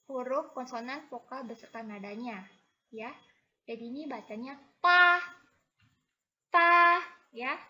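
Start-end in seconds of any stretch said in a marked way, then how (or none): 3.7-7.6 pa loud, high and level; first tone pa. Shown as a demonstration. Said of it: "Ini nada pertama ya". (pa dengan nada pertama)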